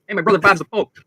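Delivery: high, loud bragging bar regular; Chicago barfly. Pretend. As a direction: brag like a guy at a Chicago bar. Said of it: "You know, Bob and Jim".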